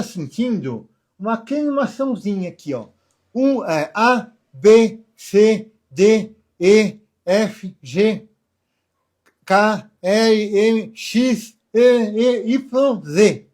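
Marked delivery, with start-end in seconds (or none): 3.3-13.5 alphabet with a pen clenched in teeth. Shown as a demonstration. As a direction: say the letters through a bitten pen. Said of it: "você vai falar o abecedário".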